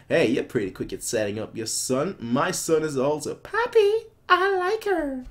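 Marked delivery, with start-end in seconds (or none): 3.3-5.2 put-on much higher, kid voice; childish little prince. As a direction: squeak it like a smitten boy. Reading prince's childish line.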